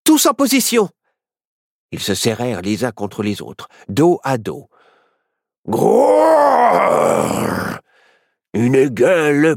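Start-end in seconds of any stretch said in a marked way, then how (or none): 0.0-0.9 high, urgent command; high-pitched battle call; rallying order. Acted. Calling friends to battle stations. 5.7-7.8 long, loud growling roar; drawn-out monster growl; dinosaur roar. Acted. Dinosaur roars at the children.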